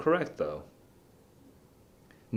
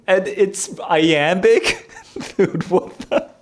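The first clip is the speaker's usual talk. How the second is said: much higher and louder, slow rise, breaking into a laugh; delighted shock at the discovery; amazed laughing disbelief; 10 semitones higher, 6 dB louder, slower than usual. Real, not acted. Discovers the question rhymes.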